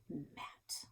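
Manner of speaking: scornful whisper of Matt; a sneering whispered Matt; whispered name. A narrator's reading. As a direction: breathe Matt out with disdain.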